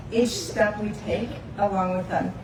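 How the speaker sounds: usual speaking voice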